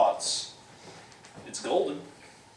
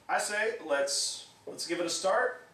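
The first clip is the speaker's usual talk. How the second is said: ordinary delivery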